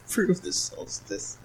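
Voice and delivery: slow whispered challenge; breathy mock dare; whispered teasing. Teasing the solstice fact.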